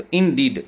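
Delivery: "in deed" with "in" light and "deed" stressed. Shown as a demonstration. Teacher demonstrating iambic stress on "in deed". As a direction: tap "in" lightly, then hit "deed". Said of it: "पहला unstressed हो गया, दूसरा stressed".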